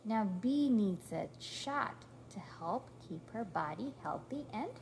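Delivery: usual speaking voice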